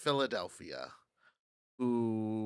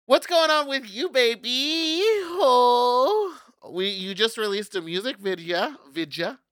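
high playful voice; 11 semitones higher, 8 dB louder, slower than usual. Acted.

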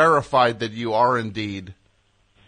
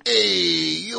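put-on New Jersey accent; 6 semitones higher than usual. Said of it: "walking around going".

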